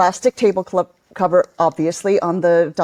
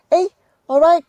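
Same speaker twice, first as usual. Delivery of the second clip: a sing-song Liverpool greeting, higher and louder; Liverpool accent; 9 semitones higher, 4 dB louder than usual. Retold or imitated. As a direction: toss off a chirpy Liverpool hello. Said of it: "it's quite sing-songy".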